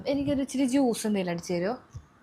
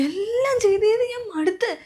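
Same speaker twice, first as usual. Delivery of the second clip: higher, louder, breaking into tears; crying out; 11 semitones higher, 4 dB louder than usual. Acted. Exhausted wife crying to her husband that she's had enough. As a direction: weep out that she's worn out.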